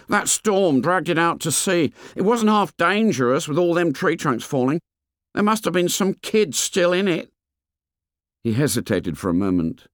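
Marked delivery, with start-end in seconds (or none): 0.1-7.3 put-on fat boy voice. A narrator's reading. Fat boy complains to the fair boy, high and whiny. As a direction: complain like a chubby schoolboy.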